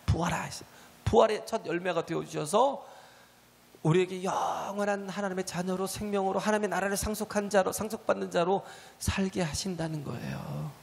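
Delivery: ordinary talk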